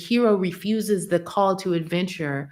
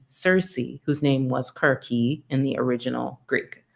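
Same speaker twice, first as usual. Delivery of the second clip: ordinary talk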